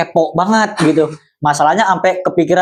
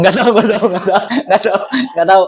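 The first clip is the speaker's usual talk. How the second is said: laughing; 5 semitones higher, faster than usual. Real, not acted. Laughing denial.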